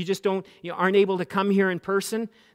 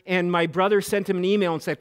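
ordinary talk

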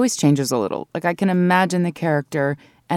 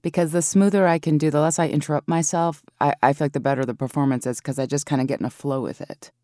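normal talking voice

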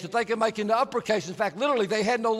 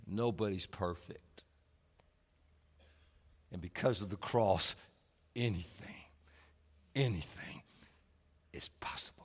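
hushed; 14 semitones lower, 11 dB quieter than usual. Acted. Quiet climax of the sermon.

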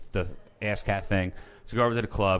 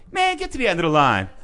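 imitated brush-off, higher and louder; dismissive bouncer; 6 semitones higher, 5 dB louder than usual. Bouncer sending hopefuls down the queue, dismissive. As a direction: wave them off like a bouncer. Bouncer sends them away.

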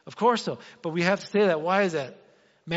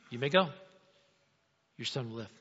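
Jesus voiced; 6 semitones lower, 5 dB quieter, faster than usual.

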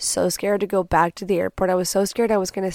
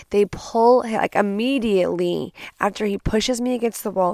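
ordinary delivery

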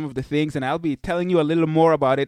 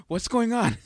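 higher, mock-startled question; startled baby; 6 semitones higher than usual. Father voicing his baby who jumps at the intro, mock startled. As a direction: voice a baby's jumpy reaction. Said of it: "He would jump like".

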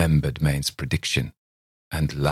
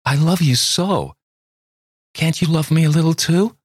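much higher and louder plea; begging young woman; 12 semitones higher, 5 dB louder than usual. A narrator's reading. Miss Thayer begs Campbell to love her, high desperate plea. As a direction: plead with him desperately.

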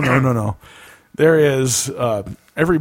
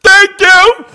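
high mimicking holler; mock yell, pitched way up; as loud as possible; 13 dB louder than usual. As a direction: shout it at full volume like an excited kid. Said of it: "as loud as he can".

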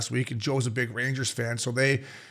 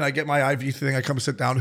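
usual speaking voice

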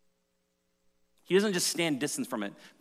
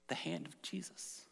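whispered; 3 semitones higher, 14 dB quieter than usual. Real, not acted. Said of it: "tender climax".

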